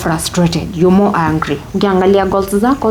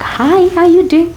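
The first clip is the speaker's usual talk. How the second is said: bright greeting; 11 semitones higher than usual. Retold or imitated. Cheerful greeting to colleague.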